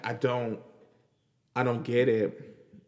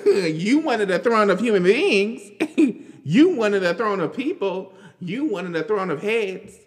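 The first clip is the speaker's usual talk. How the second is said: incredulous; 7 semitones higher, 5 dB louder than usual. Real, not acted. Mocking the pundit, laughing.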